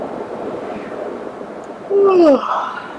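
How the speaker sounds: long airy breathy yawn; slow, drawn-out lazy lion yawn. Acted. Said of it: "The lion yawned absent-mindedly".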